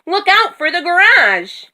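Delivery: high, urgent warning call; alarmed, high-pitched shout. A narrator's reading. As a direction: call out danger just ahead. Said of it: "Minnie shouted".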